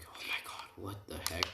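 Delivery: hushed, breath-only disbelief; shocked whisper. Retold or imitated. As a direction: whisper it as a stunned onlooker. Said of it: "they're all shocked by this".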